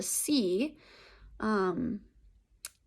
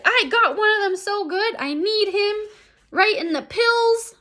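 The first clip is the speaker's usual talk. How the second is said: much higher, loud gleeful brag; excited boasting; 11 semitones higher, 5 dB louder than usual. Acted. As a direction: crow about it like a proud kid. Boy boasting about kneeing someone.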